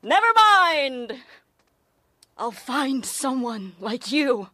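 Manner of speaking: hammed-up Macbeth-style lament; dramatic Shakespearean delivery. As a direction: wail it like a tragic heroine on stage. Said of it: "It sounds like something out of Macbeth".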